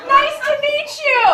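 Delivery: loud, high sugary greeting; strained politeness; forced polite welcome; prim polite mom. Retold or imitated. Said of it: "in her best Danville".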